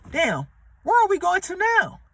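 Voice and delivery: high, lost and confused; confused panic. Retold or imitated. Stranded passenger asking where the ship is headed, confused and high. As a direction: ask like you just woke up adrift.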